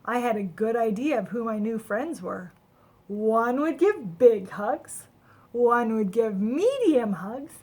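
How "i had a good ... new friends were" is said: ordinary delivery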